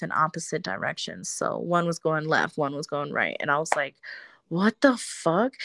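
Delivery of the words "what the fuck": slowed, drawn-out disbelief; slow stunned disbelief. Retold or imitated. Seeing hangers swing by themselves, stunned and slow. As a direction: let it hang in shock.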